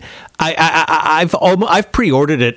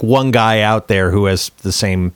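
ordinary talk

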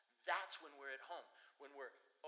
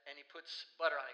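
ordinary delivery

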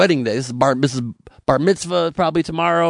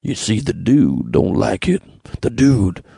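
a hint of The Dude, lower and slow; half-hearted lazy Dude voice; bad Dude impression; 6 semitones lower, slower than usual. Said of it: "It's a very bad impression".

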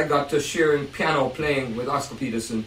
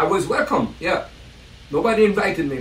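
ordinary talk

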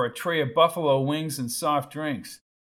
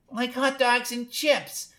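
mocking high girl voice; 10 semitones higher than usual. The blonde mocks Wendell.

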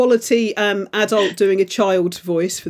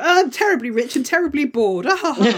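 posh, higher mock socialite with a fake laugh; bored rich divorcee; 6 semitones higher than usual. Mock rich divorcee describing her film character, high fake laugh. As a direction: play a bored rich lady tittering. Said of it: "it's like".

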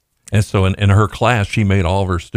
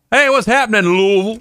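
high-pitched mock greeting; exaggerated high holler of hello; hailing by nickname; faster than usual. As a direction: call out a buddy's nickname, way up high. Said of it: "that was my nickname".

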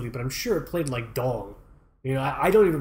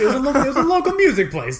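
excited protest; 10 semitones higher, 7 dB louder, faster than usual. Real, not acted. Laughing defense of illegality.

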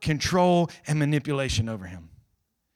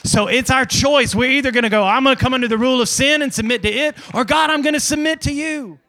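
loud, high, surging sermon cry; preacher crying out the choice; full-force preaching; 9 semitones higher, 7 dB louder than usual. Real, not acted. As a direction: build to a shouted declaration to God.

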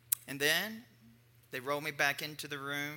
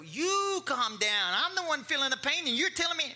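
exasperated outburst; 11 semitones higher, 7 dB louder than usual. Retold or imitated. Retold clash with the ER nurse, shouted indignantly. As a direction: fire it back at her, furious and pitched way up. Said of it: "I go".

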